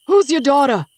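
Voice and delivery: high, loud feigned bewilderment; mock bewilderment. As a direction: act like you've never heard of her. Bound man feigns ignorance again.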